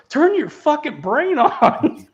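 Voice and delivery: high, loud, drawn-out imitation of outrage; slow hammed-up yell, close to laughing; mock-furious, stretching each word; exasperated mock yell. Acting out an exasperated movie line.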